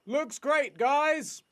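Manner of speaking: high-pitched call to the crew; bright-eyed high shout-out without extra volume; calling out approval. Acted. Narrator voices a cheer to crews.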